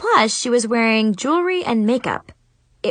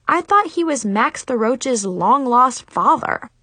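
usual speaking voice

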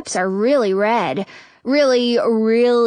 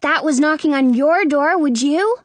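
higher-voiced kid challenging someone; child's pointed question; 6 semitones higher, 4 dB louder than usual. A narrator's reading. Kid asking the little brother, pointed. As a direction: put it to them as a pointed question.

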